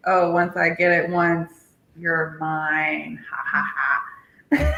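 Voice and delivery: low, smug guy voice with a slow laugh; low mock-villain gloating, ending in a slow "ha, ha, ha"; cocky guy. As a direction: play a possessive guy, low and drawn out. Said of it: "guys always think that, like".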